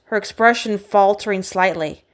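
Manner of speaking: plain reading voice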